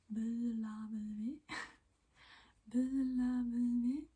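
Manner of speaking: slow, slurred, heavy tongue; numb-tongue slur. Shown as a demonstration. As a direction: drag it out like numb. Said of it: "your tongue gets really heavy".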